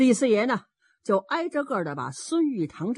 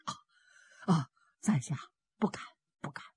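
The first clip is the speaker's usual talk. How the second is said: very soft, halting demurral; abashed humility; 4 semitones lower, 5 dB quieter, faster than usual. A narrator's reading. Qian Dacheng declines Lord Shi's praise, soft and abashed. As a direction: falter and fade nearly to a whisper. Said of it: "钱大成为什么这么说话呀".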